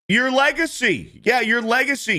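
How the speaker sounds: high mocking voice of the woman; sing-song mock of her claim.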